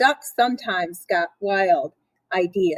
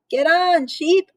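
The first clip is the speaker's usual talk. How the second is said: shouted call; 7 semitones higher than usual. Acted. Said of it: "everybody shouted".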